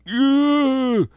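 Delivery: high, loud mock moan of pleasure; hammed-up bedroom groan; sex moan. Host mocking a couple's loud moaning, hammed-up moan. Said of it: "both of you are like".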